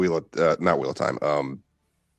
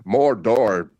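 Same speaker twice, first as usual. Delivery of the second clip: slow, rolling the R in "Mordor"; lightly hammed elf speech; mock Elvish rolled R's; slower than usual. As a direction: roll the R of "Mordor" grandly. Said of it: "is like".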